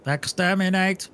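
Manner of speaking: high, slow robotic monster voice; Dalek voice. Retold or imitated. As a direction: grate it out like a Dalek. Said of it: "I sounded like a robot".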